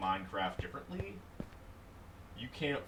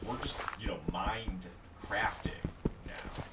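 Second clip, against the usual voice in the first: whispering. Acted. Murmured stream aside.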